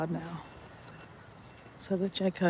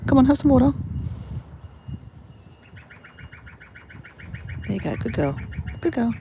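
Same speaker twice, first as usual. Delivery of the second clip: pet talk; 6 semitones higher, 8 dB louder than usual. Real, not acted. Coaxing bird to drink.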